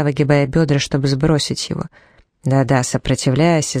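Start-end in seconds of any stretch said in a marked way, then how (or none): none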